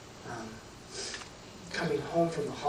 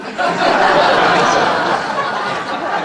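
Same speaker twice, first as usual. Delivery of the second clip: loud laugh; 12 semitones higher, 14 dB louder than usual. Real, not acted. Laughing at son's puddle line.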